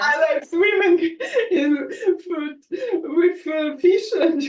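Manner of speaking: words bubbling through real laughter; laughing while talking. Real, not acted. Guest tells the host about Egypt, laughing. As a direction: chuckle your way through it.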